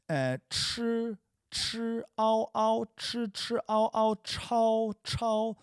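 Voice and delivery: chi, ao and chao sung out high and drawn out; Chinese syllables chi ao chao. Shown as a demonstration. Teacher modelling chi and chao for students, high and careful. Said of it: "Okay, so then we've got".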